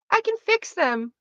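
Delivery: high, drawn-out put-on voice; sweet mock voice. Correcting a catchphrase before rejecting it, high put-on voice. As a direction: say it slowly, pitched right up.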